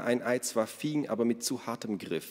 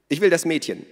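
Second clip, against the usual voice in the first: demanding; 9 semitones higher, 7 dB louder than usual. Acted. Minna demands the girl.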